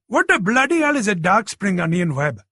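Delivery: put-on a first-generation Anglo-Bangladeshi accent, lightly done; teasing his uncle in an Anglo-Bangladeshi accent; Sonny mocking his uncle, voiced in an Anglo-Bangladeshi accent. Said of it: "Sonny did the first-generation Anglo-Bangladeshi accent".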